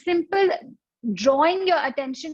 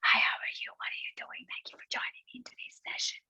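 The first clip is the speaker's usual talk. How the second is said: soft unvoiced whisper; whisper demo; 10 dB quieter, faster than usual. Shown as a demonstration. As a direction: whisper as if to the person beside you. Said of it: "Whisper to someone next to you".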